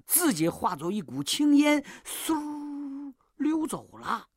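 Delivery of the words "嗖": long, soft, high whoosh; whooshing sound effect. A narrator's reading. Sound of demon slipping away. (拖长的“嗖”声)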